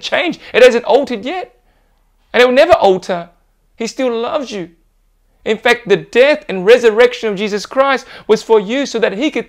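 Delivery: high, loud, driving preacher's delivery; full-force preaching. Acted. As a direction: preach it out to the whole church.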